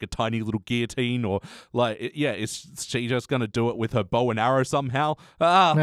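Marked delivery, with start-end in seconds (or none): 5.4-5.7 short, loud, higher "ah!"; mock scream. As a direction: cry out in comic horror. Comic exclamation after imagined gory scene.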